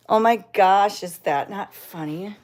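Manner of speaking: ordinary talk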